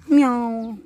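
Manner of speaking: high, drawn-out meow; cat meow. Acted. Speaker among the animals, imitated meow.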